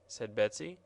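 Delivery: plain reading voice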